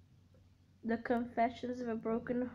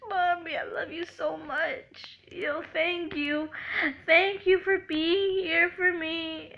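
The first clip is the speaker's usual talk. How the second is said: retold tearful thanks; 4 semitones higher, 6 dB louder than usual. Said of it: "I started crying".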